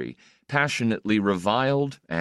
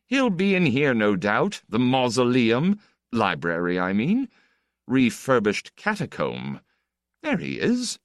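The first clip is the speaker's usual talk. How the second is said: higher, breezy nobleman; chatty count, higher voice; 5 semitones higher than usual. Acted. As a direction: chatter on like a witty aristocrat.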